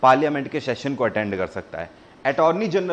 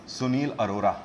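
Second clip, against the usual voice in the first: ordinary delivery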